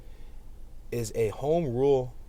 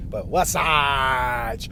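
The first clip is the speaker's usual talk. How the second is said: stoner drawl; 6 dB louder, much slower than usual. Retold or imitated. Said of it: "It reminds me of What's Happening?".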